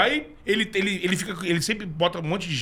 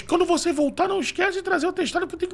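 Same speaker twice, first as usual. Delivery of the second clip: much higher crooning singer voice; [name] impression; 11 semitones higher, faster than usual. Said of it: "Tu meteu um [name] aqui".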